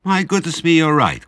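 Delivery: high, loud happy exclamation; delighted surprise. A narrator's reading. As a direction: cry out, struck by the idea. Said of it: "cried Mister Fox".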